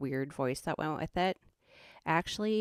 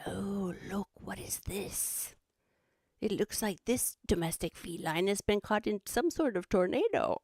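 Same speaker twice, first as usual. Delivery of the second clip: hushed Aussie wildlife host, then opening up; mock Australian nature-show commentary; Steve Irwin; 4 semitones higher than usual. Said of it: "I'd pretend I was Steve Irwin".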